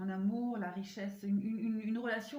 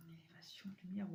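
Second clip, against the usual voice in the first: whispering; 4 semitones lower, 10 dB quieter than usual. Real, not acted. Reading cards in a whisper.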